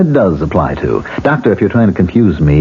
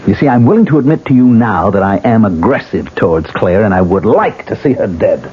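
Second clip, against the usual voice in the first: ordinary talk, nothing marked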